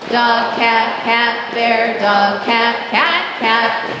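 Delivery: loud chanted word list. Shown as a demonstration. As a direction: call each word loudly in rhythm. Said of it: "ready, go".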